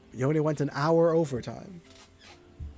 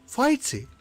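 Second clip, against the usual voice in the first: calling out; 9 semitones higher than usual. Acted. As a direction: shout the name to find him. Calling out for a friend.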